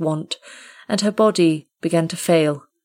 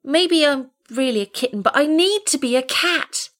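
very high kitten voice; baby animal; 9 semitones higher than usual. Acted. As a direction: squeak it like a young kitten. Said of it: "he said at last".

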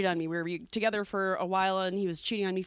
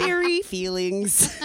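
laughing; 5 semitones higher, much slower than usual. Real, not acted. Teasing, laughing slowly.

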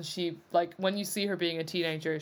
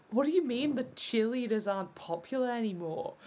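higher, incredulous Aussie voice; lightly hammed-up Aussie disbelief; Australian accent; 4 semitones higher than usual. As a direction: put on an Australian accent, affronted. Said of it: "I've missed your Australian accent".

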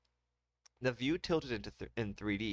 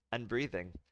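plain reading voice

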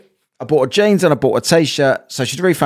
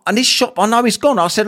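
ordinary delivery

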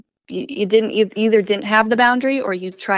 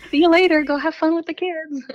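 mock cheerful send-off; 8 semitones higher than usual. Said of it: "continuing the joke".

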